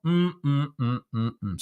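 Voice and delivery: hammed-up savoring hums; mock yummy mm. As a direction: hum as if tasting something delicious. Pretends to savor chocolate milk.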